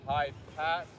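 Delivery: steady counted form calls; tai chi instructor. Acted. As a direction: announce each move and count.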